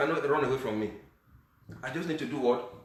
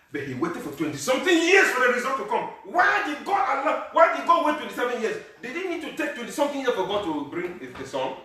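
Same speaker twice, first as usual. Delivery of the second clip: higher, louder questions to the church; rising preaching; 12 semitones higher, 8 dB louder than usual. Acted. Pastor challenging the congregation, loud and higher. Rhetorical questions about Abraham.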